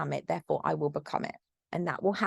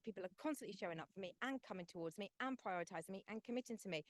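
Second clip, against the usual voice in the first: earnest manifestation affirmations, sinking softer at the end; affirmation recital; 2 semitones higher, 15 dB quieter than usual. Acted. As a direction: speak each line like a certain truth to yourself. Reading self-love affirmations aloud.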